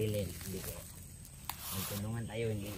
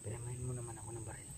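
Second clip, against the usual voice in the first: whispering; 10 dB quieter than usual. Acted. Hushed talk while hiding.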